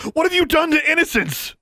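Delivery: very high, loud accusing cry; alarmed, high-pitched outcry. A narrator's reading. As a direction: demand it at the top of your range. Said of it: "she cried".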